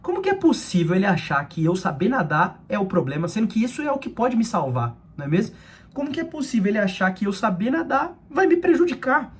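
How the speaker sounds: high-pitched, baffled retold thought; confused disbelief. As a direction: imitate a puzzled kid's incredulous questioning. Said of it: "aquilo era muito confuso".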